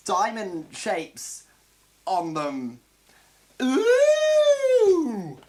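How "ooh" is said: loud, high playful "Ooh!"; high sound-effect ooh. Acted. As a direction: pop out a cartoon "Ooh!" for effect. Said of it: "there's a fun sound effect".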